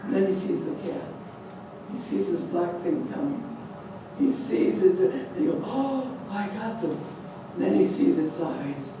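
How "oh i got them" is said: softer, slow, nervous bravado; father's brave words going soft; quiet and unsure. Acted. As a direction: mutter it slowly, not so sure now. Father sees the cat.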